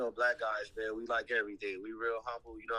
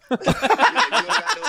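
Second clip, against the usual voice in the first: high, slow drawn-out yell; loud; 16 dB louder, much slower than usual. Acted.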